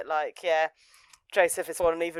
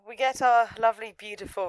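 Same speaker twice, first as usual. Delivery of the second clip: usual speaking voice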